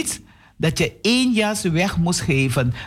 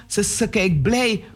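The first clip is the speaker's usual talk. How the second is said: ordinary delivery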